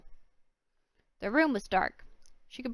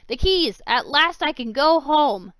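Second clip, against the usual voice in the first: high loud triumphant cry; 5 semitones higher, 11 dB louder, slower than usual. Acted. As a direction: crow in triumph. Villain triumphant.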